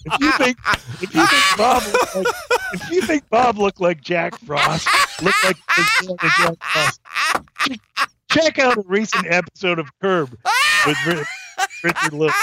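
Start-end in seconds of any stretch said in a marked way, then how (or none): 0.0-4.9 mock promo voice. Mock announcer teaser voice. 5.2-7.0 looked like Jack Frost drawn out slow and high; stretched high. Acted. Joking about aged actor, slow high stretched line. 8.3-10.4 mock promo voice. Mock announcer teaser voice.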